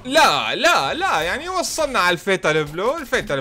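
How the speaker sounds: high, loud panicked no; alarmed cry. Real, not acted. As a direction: protest in sudden alarm. Alarm at losing health. (صرخة ذعر عالية)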